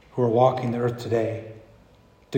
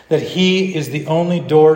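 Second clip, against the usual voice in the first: raised, emphatic declaration; firm claim; 3 semitones higher, 6 dB louder than usual. Acted. Preacher dares the church to say it, emphatic. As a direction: declare it boldly to the room. Same claim, repeated with force.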